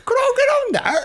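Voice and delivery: put-on squeaky, very high little-child voice; pretend child. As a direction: plead like a small Edwardian urchin. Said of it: "an Edwardian child".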